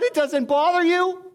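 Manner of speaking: astonished friend, played for laughs; mock shocked disbelief. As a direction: gasp it in hammed-up disbelief.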